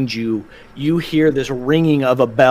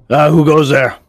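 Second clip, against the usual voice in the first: loud, raised challenge; guard's challenge; 3 semitones higher, 6 dB louder than usual. Acted. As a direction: call out like a guard on watch. Guard challenges approaching party.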